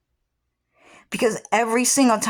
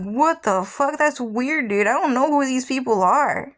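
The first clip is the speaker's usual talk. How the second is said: higher, mock-baffled reaction; weirded-out disbelief; 6 semitones higher than usual. Retelling her confused reply to the messages. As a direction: act freaked out by strange requests. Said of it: "I was like".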